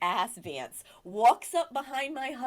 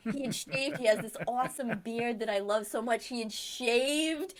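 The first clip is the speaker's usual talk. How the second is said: normal talking voice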